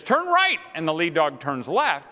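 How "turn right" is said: high, punched mock command; master's command. Sled master calling to his lead dog, commanding. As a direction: call it out to the dogs. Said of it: "And he says".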